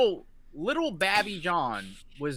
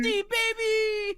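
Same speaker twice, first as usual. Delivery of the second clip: hype yell. Real, not acted. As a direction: scream it out hyped.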